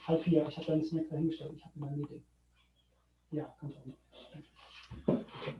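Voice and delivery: aside in German, turned off-mic; turning away from phone. Real, not acted. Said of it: "Sorry, that was my daughter".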